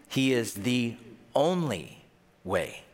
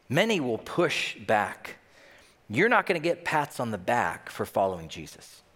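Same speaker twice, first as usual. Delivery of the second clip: ordinary talk, nothing marked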